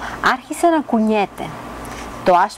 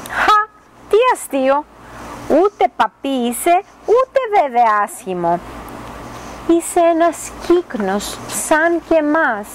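a swan delighted, breaking the good news; amused swan; 7 semitones higher than usual. A narrator's reading. A swan laughing as it tells the duckling he is a swan, high and amused.

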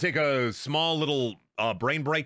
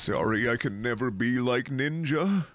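mock self-pity; 3 semitones lower than usual. Streamer apologising for not being Ninja, mock self-pity.

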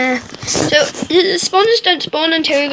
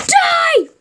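battle cry; 3 dB louder than usual. Acted. Attacking a mob.

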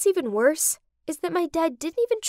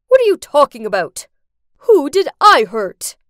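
much louder defensive shout; angry demand; 2 semitones higher, 11 dB louder than usual. Acted. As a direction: bark it back at her. Father challenged at wedding.

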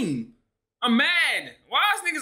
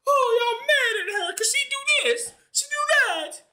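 very high, laughing mock-whine; mocking whiny fans; 13 semitones higher than usual. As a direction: parody the fans crying with a squeaky high voice. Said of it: "Every time something happened to Caitlyn".

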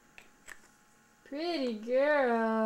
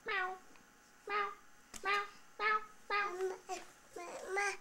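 meowing; 8 semitones higher, 7 dB quieter, slower than usual. Shown as a demonstration. Showing baby what kitty says.

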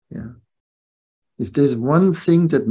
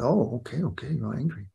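softly backing off; retold soft, placating reply; placating grandparent; 7 dB quieter than usual. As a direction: give in quietly to an angry child.

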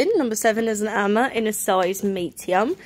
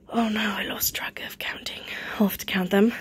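hushed, voiceless self-talk; whispered aside; 5 dB quieter than usual. Real, not acted. Seller losing count of her items, whispered aside to self. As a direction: whisper it to yourself. Muttering to herself, lost count.

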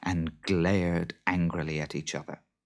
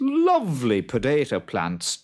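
loud sales patter climbing higher; overselling farmer; 8 semitones higher, 5 dB louder, slower than usual. A narrator's reading. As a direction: talk it up, rising until you run dry. Farmer talks up useless potato tops.

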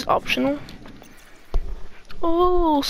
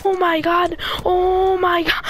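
shocked exclamation; 7 semitones higher, slower than usual. Real, not acted. Shocked gamer reacting to enemy.

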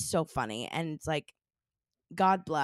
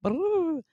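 high gobbling trill; turkey gobble; 11 semitones higher, 3 dB louder than usual. Acted. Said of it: "Is that your turkey impression?".

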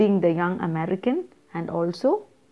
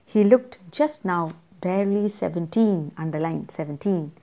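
usual speaking voice

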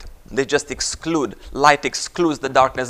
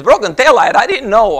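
higher, louder incredulous question; feigned surprise; 8 semitones higher, 6 dB louder than usual. Acted. Driver protesting innocence to the officer, feigned surprise. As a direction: act taken aback by the news.

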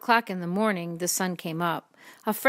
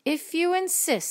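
high, lilting storybook reply; obliging reply; 11 semitones higher than usual. A narrator's reading. Creature accepting the ladybug's challenge, high and lilting. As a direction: accept the dare in a raised voice.

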